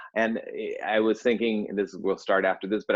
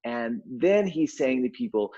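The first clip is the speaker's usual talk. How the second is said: ordinary delivery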